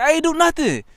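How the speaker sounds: high, loud mock-innocent denial; squealed retold denial; defensive protest. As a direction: protest your innocence with the pitch way up. Retold denial when accused.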